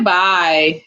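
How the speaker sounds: ordinary talk, nothing marked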